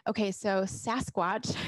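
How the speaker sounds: ordinary talk, nothing marked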